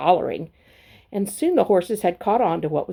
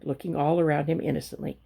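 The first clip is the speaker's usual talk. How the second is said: plain reading voice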